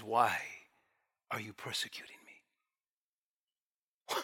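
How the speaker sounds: low, very soft and slow; gentle divine rebuke. Acted. Jesus speaking to Saul in Hebrew, gentle and hushed. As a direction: ask it gently, dropping almost to a whisper. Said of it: "isn't this the most gentle".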